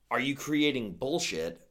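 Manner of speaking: normal talking voice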